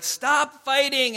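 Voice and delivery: loud, high, slow command; acted fed-up parent shouting; exasperated parental yell. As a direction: yell it at squabbling kids, drawn out. Parent breaking up kids' fight.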